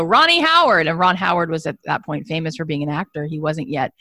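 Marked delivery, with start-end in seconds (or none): 0.0-0.9 high, loud call across a courtyard; screaming out a window. Retold or imitated. Young Brian Grazer screaming Ron Howard's name out the window, loud high call. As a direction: yell his name from far away to get his attention. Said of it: "he screamed out the window".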